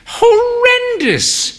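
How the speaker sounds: high, slow, drawn-out "Horrendous"; a frail dame's theatrical suffering; long-suffering old lady. A narrator's reading. Mother recalling bad town.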